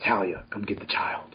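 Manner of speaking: a hushed mellow movie Batman; soft mellow voice. Retold or imitated. Showing friends how mellow the movie's Batman sounded. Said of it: "It was more like".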